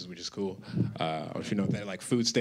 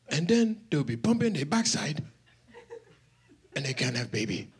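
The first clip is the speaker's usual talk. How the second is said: normal talking voice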